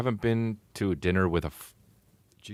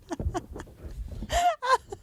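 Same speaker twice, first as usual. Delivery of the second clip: high laugh. Real, not acted. Laughs at own joke.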